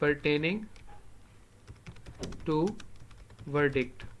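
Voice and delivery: word by word with long pauses; spaced-out classroom dictation; dictating. Shown as a demonstration. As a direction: dictate it slowly for note-takers, pausing between words. Dictating phrase while writing it.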